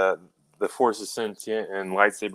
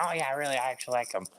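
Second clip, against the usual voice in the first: badly faked fan, higher, soft and quick; bad Davioso impersonation; 4 semitones higher, 9 dB quieter, faster than usual. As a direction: toss off a soft, hurried mock-approving voice. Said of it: "That's a really bad impersonation".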